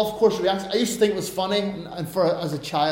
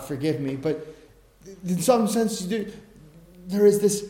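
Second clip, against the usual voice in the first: usual speaking voice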